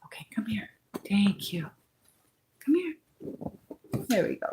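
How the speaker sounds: whispered, coaxing the dog close. Real, not acted. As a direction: barely breathe it, so she comes over. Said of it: "See if I'm quiet".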